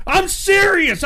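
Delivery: high, loud insistence again; emphatic. Real, not acted. Streamer repeating to chat he is serious, loud and high. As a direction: press the point, voice up high.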